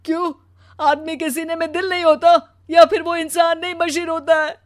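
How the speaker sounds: hurt, worn-out husband, voice rising in bursts; anguished protest. Acted. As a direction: plead to be understood, bursting out loud. टूटा पति पत्नी से फ़रियाद करता. (आहत होकर भरी आवाज़ में फ़रियाद)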